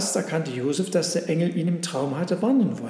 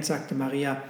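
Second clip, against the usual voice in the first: usual speaking voice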